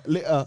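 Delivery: "later" as lay-uh, no t; British glottal stop. Retold or imitated. Host showing British speech, "later" with a glottal stop. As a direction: cut the t out of "later". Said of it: "the way they say later".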